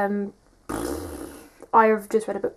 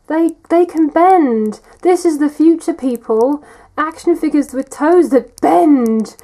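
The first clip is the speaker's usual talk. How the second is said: excited; 5 semitones higher, 7 dB louder than usual. Real, not acted. Enthusing about bendable toes.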